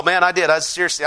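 ordinary talk

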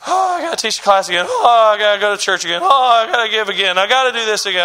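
mock weary moan, each "oh" drawn into a groan; begrudging. Imitated churchgoer moaning about serving again, begrudging. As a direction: grumble through a list of duties, hammed up. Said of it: "begrudge serving the Lord".

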